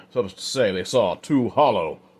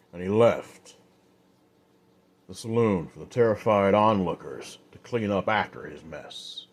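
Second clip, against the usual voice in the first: ordinary delivery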